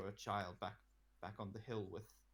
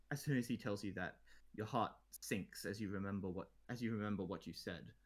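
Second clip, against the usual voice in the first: ordinary talk, nothing marked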